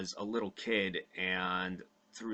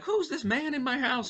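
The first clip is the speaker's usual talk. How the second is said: much higher, mock-alarmed mom voice; shrieking mom. Mom scared of a strange man in her house, high shriek. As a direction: squeal it like a startled mother. Mom doesn't recognize grown son.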